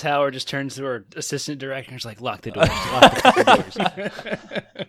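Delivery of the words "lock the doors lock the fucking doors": high, loud, laughing mock command; shouted mock order. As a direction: shout it drawn out as a hammed-up emergency order. Acted panicked order from assistant director.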